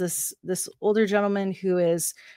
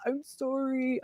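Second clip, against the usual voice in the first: meek apology; 7 semitones higher, 9 dB quieter than usual. Retold or imitated. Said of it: "he's just".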